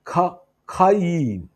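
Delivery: ഖ- alone, pause, then slow ഖയീം; syllable by syllable. Shown as a demonstration. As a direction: break off ഖ- first, then stretch ഖയീം. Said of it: "Hebrew വേർഡാണ്". (ഖ-, പിന്നെ ഖയീം എന്ന് പതുക്കെ, ഉയർന്ന സ്വരത്തിൽ)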